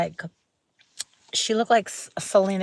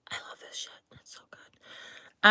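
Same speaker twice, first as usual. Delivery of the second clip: barely audible, breathy whisper; whispered gushing; 8 dB quieter than usual. Real, not acted. Vlogger praising a lip mask to viewers, whispered gushing. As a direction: whisper it slowly, savouring it.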